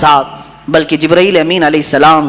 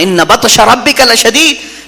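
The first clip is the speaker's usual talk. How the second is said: Quranic recitation; 4 semitones higher, much slower than usual. Acted. Quoting the Quran.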